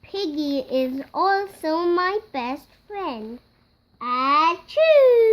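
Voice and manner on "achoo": loud, high achoo; big comic sneeze. Acted. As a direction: sneeze hard. Sneezes at the doctor.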